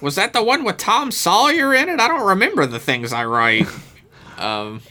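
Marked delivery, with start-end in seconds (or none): 0.0-3.7 high, put-on dotty old author voice; lightly voiced absent-minded novelist; mock forgetful Mark Twain. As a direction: play a vague old writer who forgot his own book.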